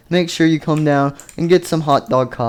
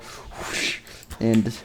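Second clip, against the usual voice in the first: ordinary delivery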